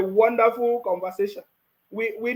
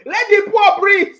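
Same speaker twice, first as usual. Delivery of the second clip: mock plea; 6 semitones higher, 9 dB louder, slower than usual. Mock plea after laughing at critics.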